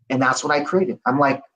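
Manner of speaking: usual speaking voice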